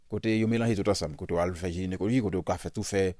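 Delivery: usual speaking voice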